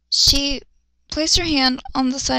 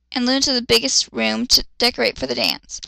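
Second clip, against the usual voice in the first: ordinary talk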